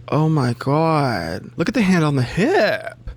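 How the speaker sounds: drawn-out, swooping "oh my God"; gushing delight. Retold or imitated. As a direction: gush over it, stretching the key words. Quotes own gushing reaction to guest.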